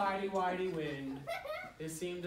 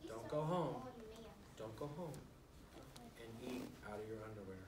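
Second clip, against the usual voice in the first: softer, serious warning; serious mode; 9 semitones lower, 14 dB quieter than usual. Real, not acted. Author warning the kids not to copy, soft and serious. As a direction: drop the voice and get serious. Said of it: "serious mode".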